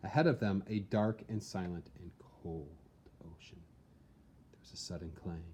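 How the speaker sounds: usual speaking voice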